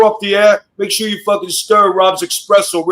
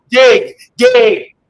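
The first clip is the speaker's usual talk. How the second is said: loud, higher bark of an order; shouting at him; 5 semitones higher, 5 dB louder than usual. Real, not acted. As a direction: yell it at him to pay up. Host roasting guest for money.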